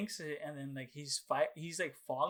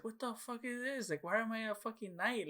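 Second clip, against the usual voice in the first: mock outrage; 8 semitones higher, 3 dB quieter than usual. Disappointed by the game.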